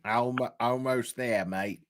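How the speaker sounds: soft, tentative try at an Aussie accent; Australian accent attempt. Shown as a demonstration. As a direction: quietly practise the Australian sounds. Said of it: "but I can't get it".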